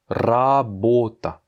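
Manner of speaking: "Работа" very slow, rolled R; drawn out for learners; slow Russian word. Shown as a demonstration. Teacher slowly modelling a Russian word with rolled R. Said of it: "Voici quelques mots qui contiennent".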